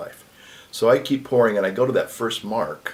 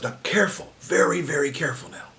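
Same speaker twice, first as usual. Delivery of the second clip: whispered, tense caution; retold priest warning over the drops, whispered; hushed warning; 6 semitones higher than usual. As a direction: breathe the warning, very careful.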